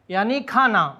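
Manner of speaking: normal talking voice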